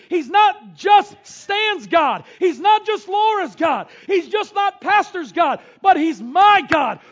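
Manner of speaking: loud preaching, slowing on "my God". Acted. Pastor proclaiming to the congregation, full-force preaching. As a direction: proclaim it to the church.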